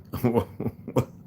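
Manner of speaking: high-pitched laugh at himself; amused laugh. Real, not acted. Streamer cracking up at his own sign-off, amused laugh.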